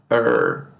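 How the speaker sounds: "er" as one low, held curled R; English R sound. Shown as a demonstration. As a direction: hold a low "er" with tongue curled. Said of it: "terá essa produção".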